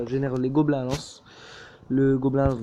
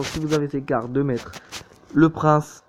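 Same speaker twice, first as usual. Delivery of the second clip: usual speaking voice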